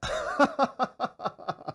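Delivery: laugh. Real, not acted. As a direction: burst of high laughter. Laughs at own mangled word.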